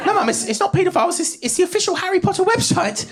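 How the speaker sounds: put-on high-pitched protesting kid; child's voice. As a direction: plead with mum in a high little voice. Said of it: "I said".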